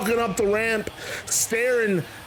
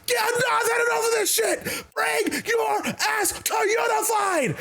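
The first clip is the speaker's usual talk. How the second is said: yelling. Retold or imitated. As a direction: scream him into the ring, fed up. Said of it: "And he's yelling".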